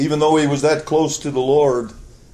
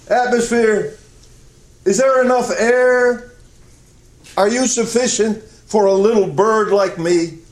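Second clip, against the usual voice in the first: higher pleading little bird, a touch louder; 8 semitones higher, 3 dB louder than usual. Acted. Little bird asking the atmosphere for air, in a higher, small pleading voice. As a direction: ask like a tiny bird begging the sky.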